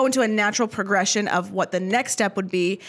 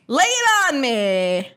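dramatic invitation; 3 semitones higher, 3 dB louder, much slower than usual. Acted. Inviting a big question.

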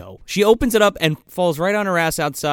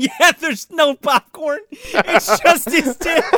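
slow, higher words through real laughter; laughing; 10 semitones higher, slower than usual. Real, not acted. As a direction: barely get it out for laughing. Laughing at the popcorn joke.

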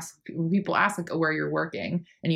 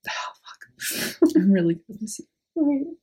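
whispered curse, then higher, tearful voice; choked up; 6 semitones higher, 4 dB louder than usual. Real, not acted. Friend facing a goodbye, really choked up. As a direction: break from a whisper into a teary laugh.

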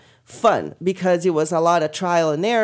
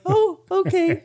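high squeaky laughing oh okay; 11 semitones higher, slower than usual. Retold or imitated. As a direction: squeak it like a surprised kid. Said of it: "that same squeaky voice".

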